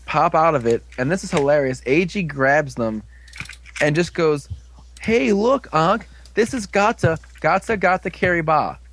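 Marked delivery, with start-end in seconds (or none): 5.0-8.8 high, goofy show-and-tell; a high, silly, delighted presentation; goofy excited. Retold or imitated. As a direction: hold them up proudly and chirp their names. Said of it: "I'm not making that up".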